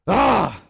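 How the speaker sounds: a loud, high frustrated "Ah!"; angry yell. Retold or imitated. Angry passenger at the desk, loud frustrated yell. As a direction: blow up with a wordless roar. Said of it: "And he just said".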